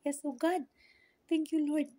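usual speaking voice

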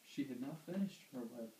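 ordinary delivery

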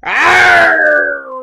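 loud, high monster roar; troll roar. Acted. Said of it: "roared the troll".

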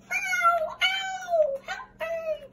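very high, crying cat voice; distressed kitty. Acted. Teacher voices the kitty crying for help, very high. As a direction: squeal like a hurt kitten.

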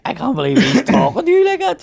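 high, outraged mates quoted; imitating mates. Retelling his mates' outrage at the rude comedian, pitched high. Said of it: "My mates were like".

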